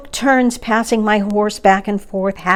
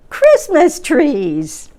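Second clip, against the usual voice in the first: higher, louder, slow cheer; shouting trolls; 7 semitones higher, 4 dB louder, much slower than usual. A narrator's reading. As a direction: trolls jumping for joy, calling it out. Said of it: "they shouted".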